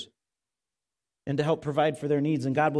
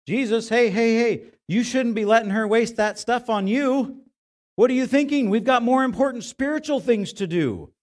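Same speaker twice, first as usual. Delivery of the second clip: louder, higher, pushy disciples interrupting; mock scolding; 7 semitones higher, 6 dB louder than usual. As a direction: butt in and set Jesus straight. Disciples scold Jesus, acted.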